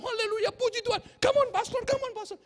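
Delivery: high, loud urging of the pastor; mock hype praise. Worshippers urging the pastor on, excited shouting. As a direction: cheer the pastor on like a fan.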